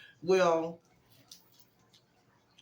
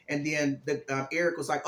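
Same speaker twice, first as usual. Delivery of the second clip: ordinary delivery, nothing marked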